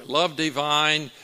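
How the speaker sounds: ordinary talk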